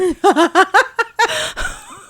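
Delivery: high burst of laughter; loud laugh. Real, not acted. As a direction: laugh out at the punchline. Laughing after punchline.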